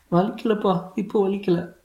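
put-on high-pitched little boy voice; small boy. A narrator's reading. Son telling his father it doesn't hurt, small boy voice. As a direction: answer as a child, pitched right up.

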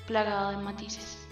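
ordinary delivery

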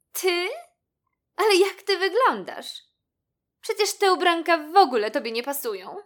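high, loud, snooty put-down; scornful kitten. A narrator's reading. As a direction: sneer at a classmate's look as a haughty kitten. Kitten scorns the mouse's clothes. (pogardliwa kotka, wysoki głos)

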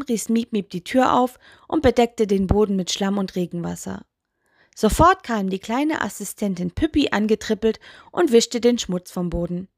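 usual speaking voice

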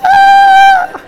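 very high, loud mock scream; long high-pitched imitation scream; imitated scream. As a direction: shriek in a squealing high voice. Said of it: "She screamed".

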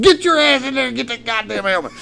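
angry order. Retold or imitated. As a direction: holler impatiently at the wife. Retold order to wife.

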